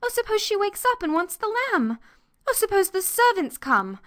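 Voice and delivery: put-on high, distraught girl near tears; tearful panic. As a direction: fret in a high voice, building to a wail. Anthea panics, breaks into tears.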